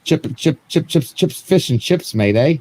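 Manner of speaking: a lightly put-on British accent, half joking; hammy mock British accent. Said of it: "Finish this off in British accent".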